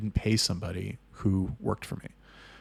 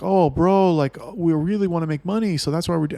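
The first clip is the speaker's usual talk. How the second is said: high, loud pleading excuse; arguing back; 8 semitones higher, 5 dB louder than usual. Retold or imitated. Manager arguing to excuse himself, pleading protest. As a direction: imitate someone protesting his case. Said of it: "he's arguing with me".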